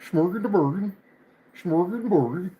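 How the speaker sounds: high, lilting mock-Swedish gibberish; Swedish Chef. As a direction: do a silly high Swedish Muppet babble. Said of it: "something like that".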